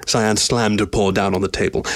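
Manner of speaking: plain reading voice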